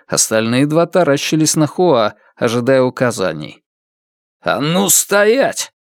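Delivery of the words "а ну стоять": slow, sharp higher command; sharp shout. Acted. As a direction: snap out a slow stop order. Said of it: "резко выкрикнул тот".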